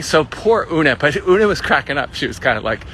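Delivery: usual speaking voice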